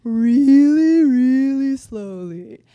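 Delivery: very slow. Shown as a demonstration. Teacher showing students how slowly Eeyore talks, drawn out. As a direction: stretch every word like Eeyore. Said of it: "how freaking slow does Eeyore talk".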